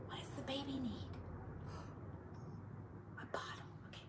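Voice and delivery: soft whisper. Real, not acted. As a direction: keep it quiet, bedtime. Whispering to child at bedtime.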